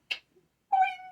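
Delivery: very high, loud cartoon boing; comic boing. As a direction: pop it out like a spring. Comic sound effect for arousal.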